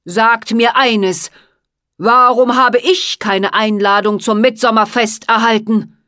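slice each word cold and loud; cutting voice. Acted. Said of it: "mit schneidender Stimme".

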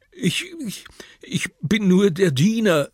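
hoch gepresst, stockend ängstlich; stammelnd. Acted. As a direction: stottert vor Angst. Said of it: "stammelt er". (der alte Diener stammelt vor den Piraten)

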